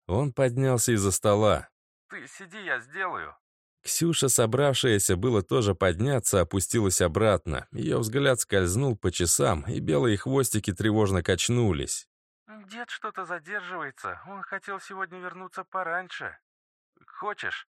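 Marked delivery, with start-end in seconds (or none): 2.1-3.4 put-on soft, high child voice; a gentle little boy; small boy voice. A narrator's reading. Child speaks to Ksyusha. 12.7-17.7 put-on soft, high worried girl; a young girl fretting quietly; little girl voice. A narrator's reading. Girl worries about her grandfather.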